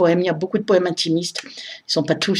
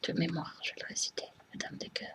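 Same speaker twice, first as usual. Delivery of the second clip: barely audible murmur; hushed, near-whispered aside; murmuring to herself; 21 dB quieter than usual. Real, not acted. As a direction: whisper it under your breath before reciting. Murmuring to herself before reciting.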